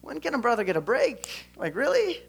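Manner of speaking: high, pleading disbelief at God; a fed-up man asking heaven for a break; exasperated complaint. Retold or imitated. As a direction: throw up your hands, half-laughing frustration. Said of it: "he's probably sitting there thinking".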